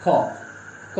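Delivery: क, ख whispered out with no voicing at all; voiceless consonants demo. Shown as a demonstration. Teacher shows students voiceless क, ख, unvoiced and breathy. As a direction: breathe out क, ख without any throat buzz. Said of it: "बिल्कुल कंपन नहीं होगा".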